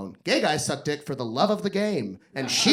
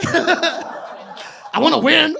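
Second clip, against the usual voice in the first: slow, higher, laughing; laughing wish; 10 semitones higher, 5 dB louder, much slower than usual. Acted. Comedian on Xanax declaring he wants to win, laughing. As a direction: drag it out through laughter. Mock competitive drugged self.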